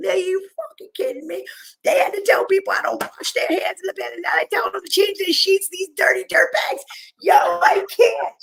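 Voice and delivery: high, loud exasperated yell; venting at the news from the hallway, yelling; retold yelling. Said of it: "I was yelling from the hallway".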